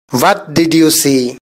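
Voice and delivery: slow, careful English sentence; slow model reading. Shown as a demonstration. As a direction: say each word slowly and clearly. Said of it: "teacher says sentence slowly".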